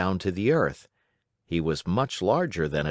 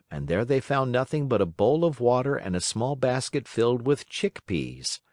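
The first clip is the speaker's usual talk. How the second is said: ordinary delivery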